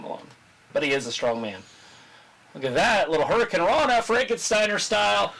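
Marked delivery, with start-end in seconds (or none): 2.5-5.3 hoarse, straining voice; losing-voice rasp. Real, not acted. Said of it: "I'm losing my voice".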